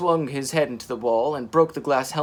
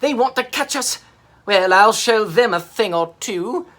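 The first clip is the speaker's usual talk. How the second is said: high, loud blustering voice; angry monster threat; 7 semitones higher, 7 dB louder than usual. Acted. Mira vowing to attack the sub, loud and angry. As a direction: puff up and threaten.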